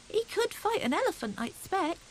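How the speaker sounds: put-on high, eager small boy's boast; a small hedgehog bragging to his cousins; little hedgehog voice. Little hedgehog boasting to his cousins, high and eager. Fuzzy Peg's hopeful thought.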